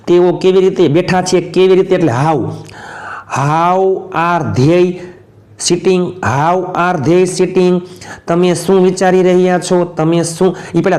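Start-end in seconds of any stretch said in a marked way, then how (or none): 3.4-6.0 slow dictation. Shown as a demonstration. Teacher models English sentence slowly. 6.0-7.8 slow dictation. Shown as a demonstration. Teacher models English sentence slowly.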